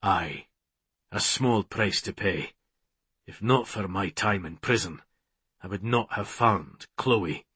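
low, soft, steady reply. A narrator's reading. Man answering his tormentor about prison, steady. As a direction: answer evenly, low and quiet. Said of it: "his tone was steady when he said".

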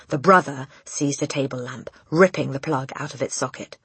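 ordinary delivery, nothing marked

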